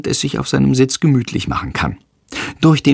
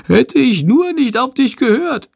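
very high, squeaky moaning; whining bat. A narrator's reading. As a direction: whine about the flight. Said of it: "jammert Shandor".

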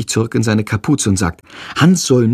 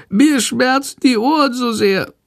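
high-pitched sick-bat whine; small, pitiful squeaky voice; meek whimpering; 11 semitones higher than usual. A narrator's reading. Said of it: "jammert die kranke Fledermaus ganz kleinlaut".

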